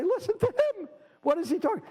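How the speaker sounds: very high mock-exasperated voice; acted indignant woman, high-pitched. Women scoff at Tevye's ramble.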